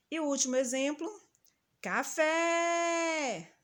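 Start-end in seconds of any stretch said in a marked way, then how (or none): 1.8-3.5 café with a high, long, held FÉ; high sustained call on the FÉ of café; calling out, drawn-out. Shown as a demonstration. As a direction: call out café, stretching the last syllable fé. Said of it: "A sílaba que nós demoramos mais pronunciando".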